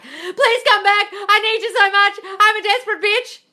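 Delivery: high, loud, slow whiny pleading; pleading and loud, dragged out like a needy ex; mock desperate begging. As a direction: ham up a desperate beggar. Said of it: "Begging is like".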